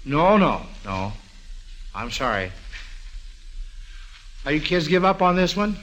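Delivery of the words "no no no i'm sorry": usual speaking voice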